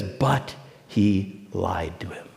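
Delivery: low, very slow reveal; preacher revealing the lie, slow and low; slow, grave aside. A narrator's reading. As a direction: let each word drop heavily. Reading the scripture aside.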